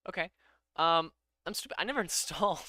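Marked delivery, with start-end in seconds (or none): none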